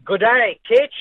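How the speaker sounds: a put-on Australian accent on the hello; mock Aussie greeting. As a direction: greet him in a jokey Aussie twang. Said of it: "what is that, a Russian accent?".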